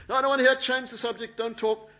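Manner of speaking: high, loud snapping refusal; mock angry refusal. Preacher playing the woman angrily refusing the blessing.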